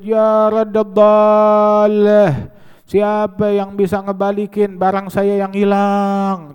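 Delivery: long-drawn, high loud wail; acted full-voiced public cry. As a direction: call out slowly like a street crier, stretching the vowels. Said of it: "dia teriak ngejerit".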